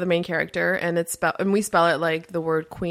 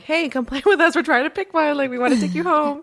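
higher, excited coaxing call; calling to dogs; 8 semitones higher than usual. Retold or imitated. Couple chasing shelter dogs around the castle, higher excited calling. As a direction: beg the dogs to notice you, eagerly.

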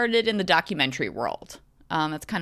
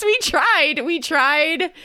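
higher, louder mock despair; exasperated; 9 semitones higher, 6 dB louder, much slower than usual. Real, not acted. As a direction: wail that they tried. Lamenting the bad sequel.

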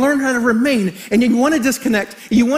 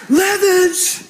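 high-pitched mock shout from a coach; imitated coach's yell; 8 semitones higher than usual. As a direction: call the player out like a yelling coach. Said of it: "as he's yelling at him".

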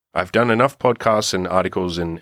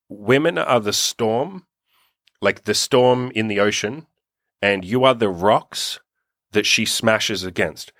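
slow, weighty quote read; quoting slowly; 2 semitones higher, slower than usual. Retold or imitated. Reciting a quote to listeners, slow and weighty. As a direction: let each image land slowly. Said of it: "a great quote that basically said".

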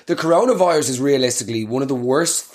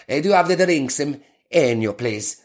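mock Italian accent. Shown as a demonstration. Ordering drinks at the bar in mock Italian accent. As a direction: put on a broad comic Italian accent. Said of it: "order your drinks in a northern Italian accent".

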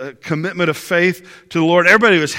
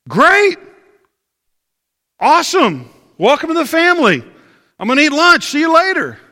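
breezy brush-off; 9 semitones higher, 4 dB louder than usual. Retold or imitated. Pastor brushing off the devil question.

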